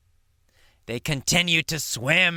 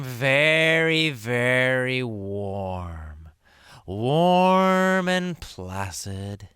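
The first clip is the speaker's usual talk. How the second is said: slow soothing; 5 semitones lower, much slower than usual. Shown as a demonstration. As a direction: stretch out the warmth. Savouring calm warm water.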